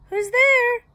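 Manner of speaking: very high, loud granny voice; shrieky old granny calling to the door. A narrator's reading. Granny answering a knock at her door, high loud call. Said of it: "called out a voice".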